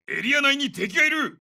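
high, urgent military callout; urgent warning. Acted. Soldier warns squad of enemy in the area, urgent. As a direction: raise the alarm to the squad.

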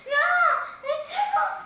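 being tickled. Real, not acted. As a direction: giggle the words out. Tickled on the leg.

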